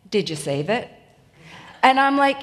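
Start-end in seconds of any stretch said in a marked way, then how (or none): 0.1-0.9 imitating husband. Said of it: "And he'll say".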